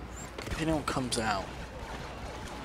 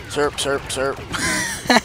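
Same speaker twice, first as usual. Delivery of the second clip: zapping sound effect; 3 dB quieter, much slower than usual. Pretend. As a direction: make little blaster noises. Imitated blaster noise.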